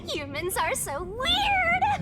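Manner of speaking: high, slow gleeful exclamation; slow high amused shout; amused exclaim. Acted. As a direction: tease humans with delight. Laughing mockery of humans.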